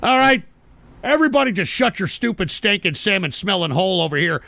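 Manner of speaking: ordinary talk, nothing marked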